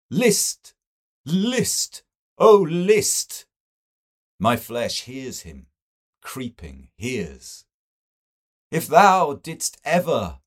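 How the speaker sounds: high, loud hammed-up ghostly wail; mocking a spooky ghost story. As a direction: ham up the ghost's call to frighten. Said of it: "he wants to make our flesh creep".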